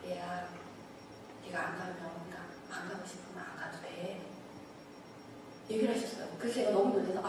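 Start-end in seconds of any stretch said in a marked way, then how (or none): 0.1-4.2 soft, hushed fatherly voice; a lightly voiced God, much softer; gentle voice of God. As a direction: speak low and tender, like a father to a child. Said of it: "하나님께서 말씀하셨어요".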